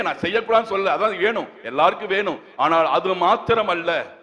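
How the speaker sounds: firm, insistent sermon voice; emphatic preaching. Acted. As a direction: exhort the congregation with conviction. Preaches exhortation to congregation.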